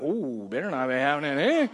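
sassy put-on voice with a sharp rise at the end; attitude. Lady in the congregation reacting, sassy attitude. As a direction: give it some sass. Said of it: "someone already got an attitude".

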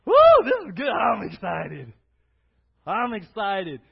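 mock giddy trail cheer; excited hiker whoop. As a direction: cheer like a hiker starting out fresh. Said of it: "my guess is".

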